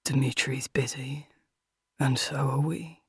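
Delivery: low, soft villain in the ear; near whisper. A narrator's reading. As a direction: murmur it low with quiet menace. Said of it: "almost whispering in her ear".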